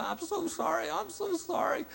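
high, fast embarrassed apology; flustered apology. Retold or imitated. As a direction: gush out an embarrassed sorry. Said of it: "he started to apologize".

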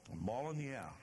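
half-hearted bawling out; mild scolding. Retold or imitated. Jesus scolding his disciples, mild reproach. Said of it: "even though he just bawled them out".

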